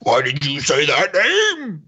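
slow strained movie-line outburst, swooping up at the end; hammed-up dramatic demand; Zack Snyder. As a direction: drag the line out slowly into a big overdone cry. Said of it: "That's my impression of Zack Snyder".

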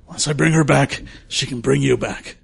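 usual speaking voice

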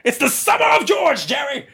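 high, shouted sitcom impression; hammed-up excited yell at a sitcom pal; George. Friend as the sitcom sidekick crowing to his pal, high shout. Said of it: "like Jerry Seinfeld".

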